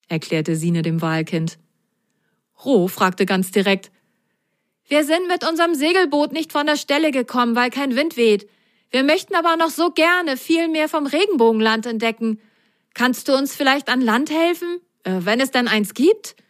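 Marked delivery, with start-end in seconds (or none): 4.9-16.4 high, hopeful little sock asking for help; high child voice, fast at the start; sock boy voice. Acted. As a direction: play Ro, direct and keen. Ro the sock asks.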